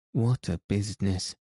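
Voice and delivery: usual speaking voice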